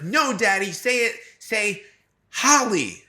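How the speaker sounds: put-on high childlike voice, insistent; imitating little daughter. Daughter correcting her dad's pronunciation, high childlike voice. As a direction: correct daddy like a small girl. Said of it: "She's like".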